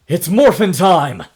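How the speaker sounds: battle cry. Acted. Dustin's father shouting his battle cry to the crowd. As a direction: belt out the call to transform. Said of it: "takes a bre-, deep breath and goes".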